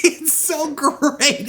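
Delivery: drawn out high through a laugh; slow, laughing delight. Real, not acted. Host gushing about the film, slow and high through laughter. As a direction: savor it, still laughing. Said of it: "host laughing".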